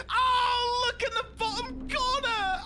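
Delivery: high shouted squeal; excited cry. Real, not acted. Streamer telling chat to look, high thrilled shout.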